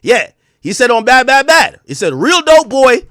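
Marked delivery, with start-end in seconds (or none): 1.1-1.8 high, loud rap-line quote; confident. Retold or imitated. Quoting a rapper's boastful lyrics, confident. 2.1-3.1 high, loud rap-line quote; confident. Retold or imitated. Quoting a rapper's boastful lyrics, confident.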